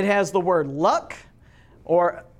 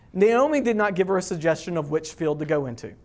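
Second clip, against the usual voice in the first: ordinary delivery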